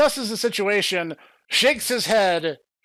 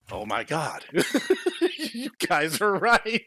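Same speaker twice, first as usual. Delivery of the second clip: laughing realization; 4 semitones higher than usual. Retold or imitated.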